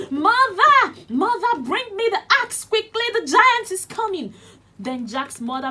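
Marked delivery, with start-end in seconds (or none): 0.1-4.3 very high, very loud panicked yell; Jack shouting. Acted. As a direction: scream for help while fleeing.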